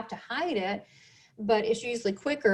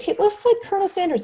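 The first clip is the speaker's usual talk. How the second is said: higher and louder, delighted as she repeats it; excited retelling; 10 semitones higher, 7 dB louder, faster than usual. Retold or imitated. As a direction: burst out with the punchline, thrilled.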